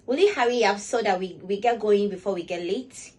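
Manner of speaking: loud, pushy hurry-up to a friend; loud impatient version to a dawdling friend; harsh impatient demand. Shown as a demonstration. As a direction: snap at a late friend. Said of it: "My tone of voice".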